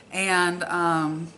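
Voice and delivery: usual speaking voice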